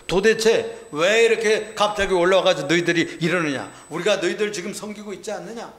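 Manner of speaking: 높고 힘주어 따지는 말투; 사신이 되어 언성을 높여 항의하듯 묻기. Acted. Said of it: "사신을 보내가지고 물었어요". (다그치듯 높인 목소리)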